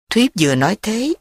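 ordinary delivery, nothing marked

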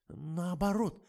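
high timid squeak. A narrator's reading. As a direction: squeak the correction. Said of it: "пискнул Жорик".

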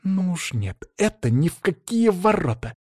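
high-pitched scandalised woman; indignant outrage. Acted. Марина возмущена поведением Данилы.

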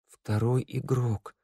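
soft, slow mumble through numb lips; mumbling. Acted. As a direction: mutter it with stiff lips, slowly. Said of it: "пробормотала девушка непослушными губами".

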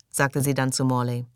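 usual speaking voice

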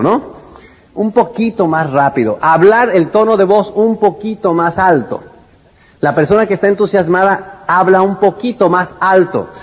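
ordinary delivery

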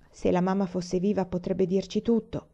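ordinary delivery

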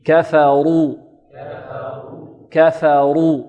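"كفروا" بكاف وفاء مرققتين وراء مفخمة; لقّن "كفروا" مرققًا الكاف والفاء ومفخمًا الراء; نطق نموذجي صحيح. Shown as a demonstration. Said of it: "انطقوا معي". (رقق أول "كفروا" وفخّم راءها)

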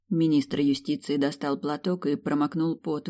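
plain reading voice

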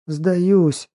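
high, pleading surrender; desperate plea. Acted. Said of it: "отчаянно моля о пощаде".

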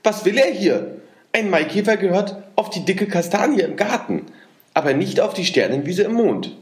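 high, squeaky and indignant; agitated little man. A narrator's reading. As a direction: huff like a furious little goblin. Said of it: "quiekte das Männchen ganz rot vor Aufregung".

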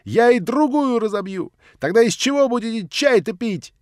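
high, loud, daring; frenzied taunt. Acted. Nelly threatens to break the other cup, enraged taunt. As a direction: taunt him in a rage. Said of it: "как взбешённая".

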